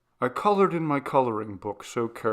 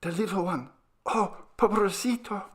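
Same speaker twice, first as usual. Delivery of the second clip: high, hushed little voice; grieving child; 10 semitones higher than usual. A narrator's reading. Maria mourning her little sister, high and hushed. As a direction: sorrow of a small child. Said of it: "she whispered".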